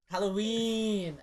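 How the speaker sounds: slow, stretched-out Halloween; drawn-out announcement. Acted. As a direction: stretch the word out slowly.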